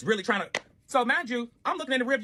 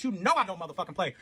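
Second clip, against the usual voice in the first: usual speaking voice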